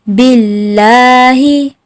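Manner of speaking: बिल्लाही with a held double l, slowly; slow drilled recitation. Shown as a demonstration. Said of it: "अब मेरे साथ मशक करें". (बिल्लाही को धीरे, ल पर ज़ोर)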